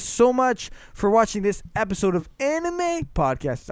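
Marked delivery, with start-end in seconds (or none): 2.4-3.7 ANIME stretched out high, then dropping on "Podcast"; showy sign-off with "Anime" elongated and pitched up; odd drawn-out show title. Real, not acted. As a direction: swoop up on "Anime" and hold it before "Podcast". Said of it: "I don't know why I say it like that".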